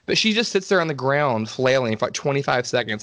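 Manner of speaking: normal talking voice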